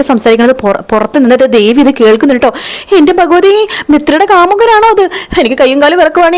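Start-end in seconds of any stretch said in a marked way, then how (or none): 3.0-6.4 shocked gasp. Retold or imitated. Devi overhears shocking secret.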